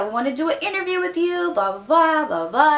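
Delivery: manager voice. Retold or imitated.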